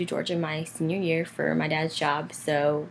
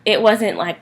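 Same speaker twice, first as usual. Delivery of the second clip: normal talking voice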